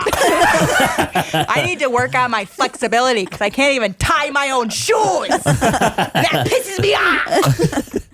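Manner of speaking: mock-exasperated boss grumbling slowly; hammed-up boss complaining slowly; Jeff impression. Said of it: "I could do my boss, Jeff".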